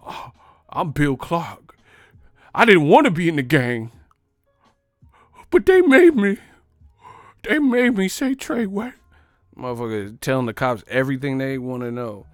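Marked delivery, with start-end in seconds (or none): 0.1-8.9 rising into a high, loud whine; whiny snitch Bill Clark. Acted. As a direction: plead like a cowardly snitch.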